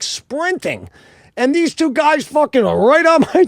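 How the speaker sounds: ordinary talk, nothing marked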